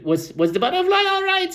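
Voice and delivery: very high-pitched little animal voice; young rabbit. Acted. Young rabbit interrupts the storyteller about the butterfly, high little voice. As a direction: squeak the question as a young rabbit. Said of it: "the young rabbit".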